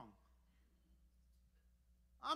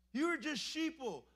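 mocking insult; 4 semitones higher than usual. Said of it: "use that as an offense".

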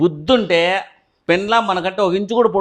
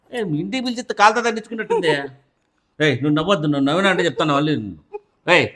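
ordinary talk

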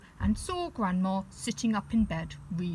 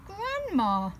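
uneasy little bear; 11 semitones higher than usual. Acted. Teddy, growing uneasy.

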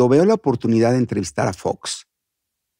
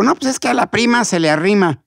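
pitched-up puppet voice; high little character making a cheeky joke; Pepo; 7 semitones higher than usual. Acted. Puppet cracking a joke to the host, high puppet voice. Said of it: "entrar con el monito".

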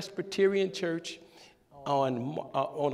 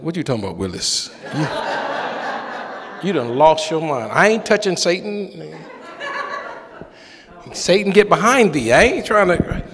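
loud, hammed-up refusal; mock indignant outburst; 9 dB louder than usual. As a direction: protest like you've been asked the impossible. Retold indignant refusal to pastor.

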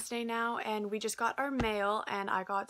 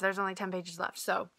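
usual speaking voice

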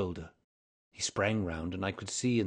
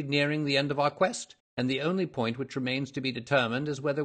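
lightly voiced detective, raised and energetic; Holmes, brisk and pleased; 5 semitones higher, 6 dB louder than usual. A narrator's reading. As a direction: reason it out to your companion. Holmes talks to Watson.

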